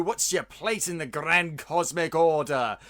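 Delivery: ordinary talk, nothing marked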